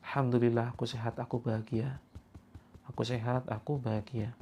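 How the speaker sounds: very soft murmured affirmation; soft demo. Shown as a demonstration. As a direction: show them how, barely above a breath. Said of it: "Seperti ini ya". (contoh afirmasi dengan suara sangat pelan)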